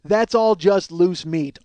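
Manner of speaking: slow mocking self-quote; slow mocking repeat. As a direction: stretch each word like it's absurd. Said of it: "more acceptable than".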